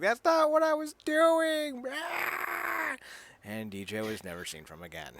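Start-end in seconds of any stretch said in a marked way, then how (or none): 0.0-1.8 much higher, slow squeaky protest; high squeaky mock Marissa. Mocking Marissa's denial to DJ, in a high squeaky voice. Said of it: "And Mercy's like". 1.9-3.0 a short high squeal; high mock shriek. Mocking Marissa as DJ leaves, with a high mock shriek. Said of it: "And Mercy's like".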